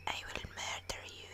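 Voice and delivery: very soft, menacing whisper; barely audible mock menace; whispered threat. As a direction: whisper it under your breath.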